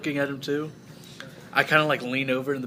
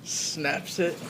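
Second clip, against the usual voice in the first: normal talking voice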